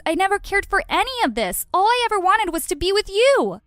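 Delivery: high, rising plea; pleading outburst. Acted. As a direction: cry it out to your mother. Daughter pleading in quarrel.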